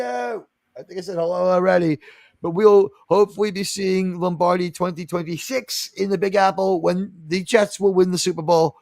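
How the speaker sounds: ordinary talk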